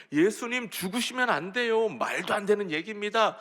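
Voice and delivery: high-pitched, urgent objection; protesting. Acted. Peter objecting that Jesus must not die, protesting. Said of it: "이러면서 예수님께 따지는 겁니다".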